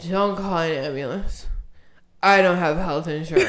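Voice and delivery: low, soft, woozy voice; imitating a passed-out friend, low and faint; barely conscious friend. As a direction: murmur it as if just coming to. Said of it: "She's like".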